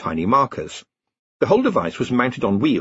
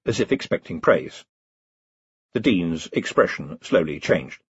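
ordinary delivery

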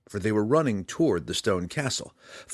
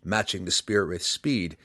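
ordinary delivery

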